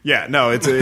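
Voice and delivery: normal talking voice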